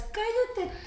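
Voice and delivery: high, soft, whimpering put-on retort; mocking whiny voice. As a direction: whine it like a sulking kid. Mocking the woman's reply. (imitación llorona y aguda)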